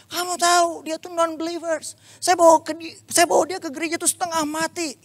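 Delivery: high-pitched mock rant; angry rant. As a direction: rant at him like an indignant friend. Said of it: "Dia marah-marah lagi". (teman marah-marah dengan nada tinggi)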